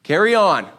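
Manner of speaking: broadcast it like a loudspeaker call to the whole cave; mock public announcement. Preacher mocking David announcing his thirst to everyone. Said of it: "It's not like David says".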